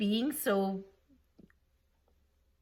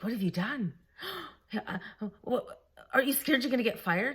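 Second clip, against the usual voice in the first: gasping, higher-pitched imitation of a worried caller; mock alarm; 5 semitones higher than usual. Boss phones about her job, mock alarm. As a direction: act out a startled boss, stumbling after a gasp.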